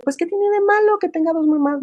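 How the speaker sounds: high, airy mock mom voice; quoting a carefree mother, high and dismissive; mocking a naive mom. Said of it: "a veces las mamás decimos".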